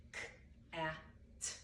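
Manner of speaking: each sound said apart, k a t; segmenting sounds. Shown as a demonstration. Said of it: "I model phoneme segmenting".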